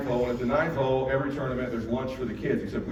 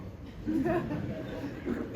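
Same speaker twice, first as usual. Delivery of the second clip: laughing at the photo; 14 semitones higher, 8 dB quieter than usual. Real, not acted.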